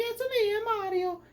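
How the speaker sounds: squeaky, loud cartoon Italian accent; Mario's Italian accent. Reviewer imitating the commercial's accents, very high Italian accent. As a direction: pipe up as an Italian plumber in an advert. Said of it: "where they're doing their accents".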